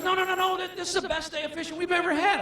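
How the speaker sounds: high-pitched imitation of a protesting client; pleading protest. Client begging guide not to leave, high pleading protest.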